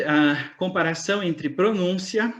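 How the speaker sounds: normal talking voice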